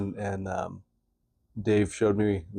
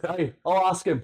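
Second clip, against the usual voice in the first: high Irish brogue; Irish accent; 7 semitones higher than usual. Retold or imitated. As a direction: do the Braveheart Irishman. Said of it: "The Irish dude".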